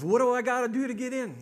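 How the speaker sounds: loud, high, brash question; hammed-up eagerness to get in; eager ex-husband. Ex-husband asks how to enter heaven, eager.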